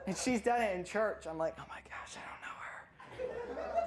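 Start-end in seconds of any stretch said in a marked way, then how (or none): 1.6-3.8 breathless whisper, slow and high; embarrassed whisper. Retold or imitated. Embarrassed daughter disowning mom in church, whispering. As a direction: hiss it so nobody hears.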